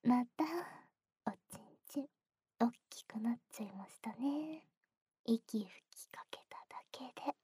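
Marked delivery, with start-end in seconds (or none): 1.2-7.3 hushed ear whisper; soft whisper. Acted. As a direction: whisper it softly and close.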